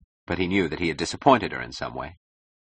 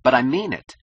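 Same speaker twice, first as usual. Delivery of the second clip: louder, higher-pitched indignant protest; stung, raised-voice retort; angry snap; 7 semitones higher, 6 dB louder than usual. Acted. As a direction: snap back, insisting on your sincerity. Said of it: "he snapped angrily".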